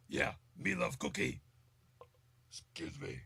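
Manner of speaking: very low, rough puppet-monster growl; Cookie Monster. Acted. Cookie-loving puppet monster talking, deep and growly. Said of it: "he does the Cookie Monster impression".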